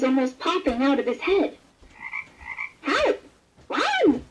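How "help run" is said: very high panicked shout; frightened cry. A narrator's reading. Frog jumps up and warns everyone, in a high panicked cry. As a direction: cry out in sudden alarm. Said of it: "he cried".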